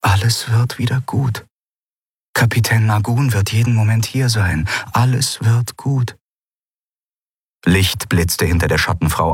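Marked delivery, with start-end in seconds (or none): 0.0-6.2 reassuring smile. Acted. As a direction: comfort her through a smile. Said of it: "schaffte er es zu lächeln".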